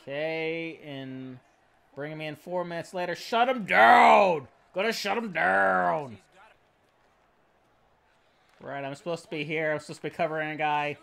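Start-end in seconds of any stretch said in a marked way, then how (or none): 3.2-6.2 louder, slow call to shut him down; slow loud urging. Real, not acted. Gamer urging his defence, loud and slow. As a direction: bark it out slowly.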